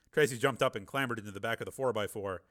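usual speaking voice

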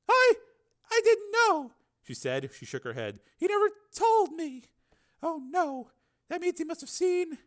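high, halting female voice; dismayed woman. Acted. Susan realizing she was watched, dismayed. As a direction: falter as the truth sinks in.

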